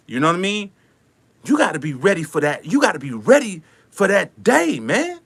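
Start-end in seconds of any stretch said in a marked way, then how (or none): none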